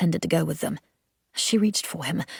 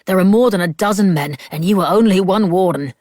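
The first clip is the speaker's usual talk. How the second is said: firm rebuke; 7 dB louder than usual. A narrator's reading. Stokes restrains Grace.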